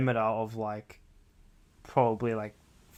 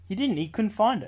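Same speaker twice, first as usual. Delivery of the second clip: high-pitched insistent correction; real squabbling objection; insistent contradiction; 7 semitones higher than usual. Real, not acted. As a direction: protest it, pitched up. Arguing over the story.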